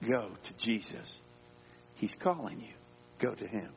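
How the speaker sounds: soft, low, gentle urging; quiet encouragement with space between phrases; encouraging. Acted. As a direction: coax someone tenderly forward. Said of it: "the one encouraging others".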